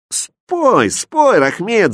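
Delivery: high, loud egging-on; eager high-pitched cheer; crowd shouting. A narrator's reading. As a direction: call out for a song from the crowd. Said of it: "закричали и продавцы".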